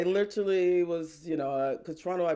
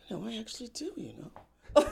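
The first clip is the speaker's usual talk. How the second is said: whispered aside; 6 semitones higher than usual. Retold or imitated. Whispered aside, then laughing.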